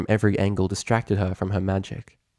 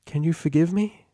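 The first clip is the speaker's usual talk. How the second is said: sincere plea; 8 semitones higher than usual. Acted. Celestia asks forgiveness.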